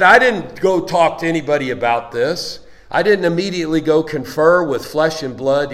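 loud preaching at full force; preacher pressing his congregation with Paul's words, loud and forceful. Acted.

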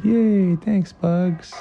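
high, happy cheer on "Yay"; a child's high thrilled thanks; little boy cheering his friend; excited Tail. Acted. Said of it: "And Tail said".

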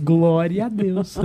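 slow, drawn-out mock praise; hammed-up pious assent; meek mock agreement. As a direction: stretch it out as a dutiful, comic amen-sayer. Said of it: "e eu só concordo".

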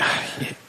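soft breathy sigh of defeat; resigned sigh. Retold or imitated. To his girlfriend before confessing, resigned sigh. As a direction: let out a caught sigh before admitting it.

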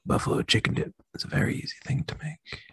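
breathy seductive whisper; sultry whisper. Acted.

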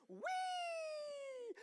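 put-on high, gleeful kid squeal; kid's gleeful squeal. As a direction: squeal like a thrilled toddler. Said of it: "I hear Jordan going".

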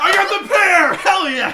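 excited. Real, not acted. Wins the jelly bean gamble.

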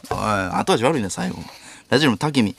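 ordinary delivery

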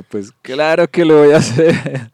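slow emphatic "of course I will"; emphatic retort. Retold or imitated. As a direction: stretch it out loudly, gleefully defiant. Retelling his defiant reply to mother.

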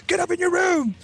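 high, strained mock-angry command; dad ordering. Dad sending kid away after beating.

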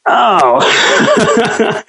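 mock dismay. Real, not acted. Host never watched the show.

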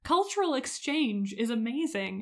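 plain reading voice

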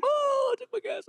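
mock a whole stadium's gasp; stadium crowd oh. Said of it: "and the whole stadium".

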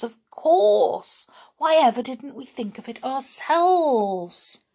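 high-pitched burst of realisation; excited cry. A narrator's reading. Children realise the way in, crying out excitedly. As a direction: exclaim it loudly and high, delighted to realise. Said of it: "cried the children all at once".

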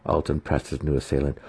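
ordinary delivery

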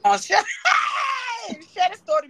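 loud, eager demand for the story; loud playful hype; excited call. Real, not acted. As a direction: shout for her to spill it.

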